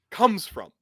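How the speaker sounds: usual speaking voice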